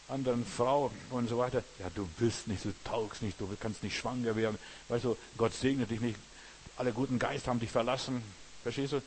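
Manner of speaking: usual speaking voice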